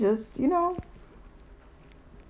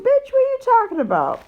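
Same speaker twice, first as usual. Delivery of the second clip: much higher, fast, disbelieving snap; incredulous retort; 12 semitones higher, 3 dB louder, faster than usual. Retold or imitated. Narrator retorting to Sally at the table, high and outraged. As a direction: fire it back in outraged disbelief. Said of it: "I'm like".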